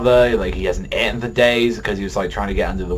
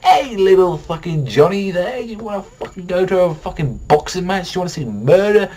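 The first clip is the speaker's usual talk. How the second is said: higher, hammed-up cheery dad; mock bad dad; 7 semitones higher than usual. Mock father luring his little son to boxing, cheery higher voice. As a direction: sell murder like a treat to a kid. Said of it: "where I say".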